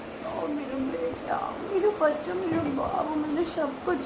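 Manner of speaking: quiet, drawn-out motherly affection; tender cooing. Real, not acted. Mother cuddles and coos over child.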